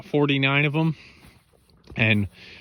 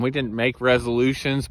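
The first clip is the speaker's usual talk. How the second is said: ordinary talk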